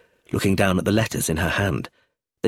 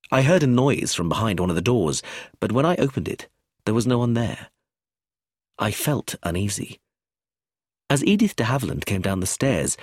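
usual speaking voice

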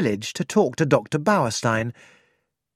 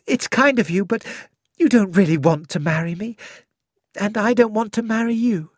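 a young woman pleading for advice, voiced higher; higher, anxious young woman's voice; young Cynthia; 6 semitones higher than usual.

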